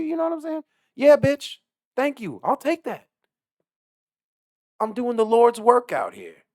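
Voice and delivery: very high, loud sugary lady; high mocking lady voice. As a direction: mimic her saccharine politeness.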